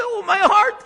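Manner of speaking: high, pained cry of a man collapsing; gasping in pain. Retold or imitated. A stranger collapsing in the street, high pained cry. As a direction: clutch your chest and cry out high.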